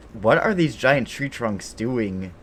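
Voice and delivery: usual speaking voice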